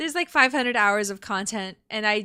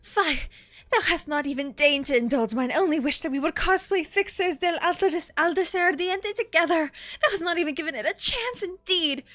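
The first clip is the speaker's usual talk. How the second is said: put-on indignant, theatrical British accent, pitched up; 3 semitones higher than usual. Streamer voicing an offended game character, haughty British accent. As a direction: declaim it like a haughty British knight. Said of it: "I give her a British accent".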